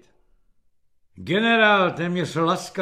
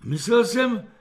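higher-pitched, hurried excuse; apologetic; 8 semitones higher, 5 dB louder than usual. A narrator's reading. Soldier apologising to the general, hurried excuse. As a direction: excuse yourself quickly to a superior. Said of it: "omlouval se Kefalín".

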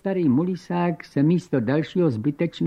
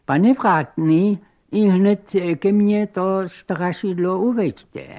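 high, louder, drawn-out summons; commanding countess; 6 semitones higher, 5 dB louder than usual. Acted. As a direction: give the servant a grand order.

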